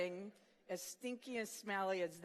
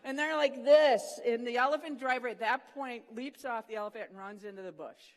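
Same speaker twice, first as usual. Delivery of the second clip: normal talking voice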